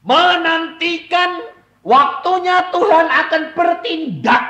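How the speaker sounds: loud, slow, high proclamation from the pulpit; full-force preaching. Acted. Preacher exhorting the congregation, loud and high. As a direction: thunder it out to the congregation.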